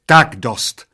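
loud, sharp shout of a waitress; waitress shrieking at two rowdy old men. Acted. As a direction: cut them off with a yell. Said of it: "zaječela číšnice".

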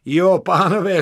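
high, loud address to the gentlemen; emphatic call. Acted. Narrator lecturing the gentlemen, loud and high. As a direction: announce it loudly.

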